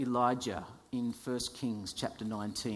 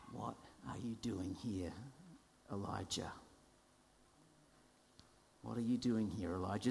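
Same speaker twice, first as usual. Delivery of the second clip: much softer, hushed question; gentle voice of God; 4 semitones lower, 10 dB quieter than usual. Acted. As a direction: ask it gently, almost under the breath. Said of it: "came a gentle whisper".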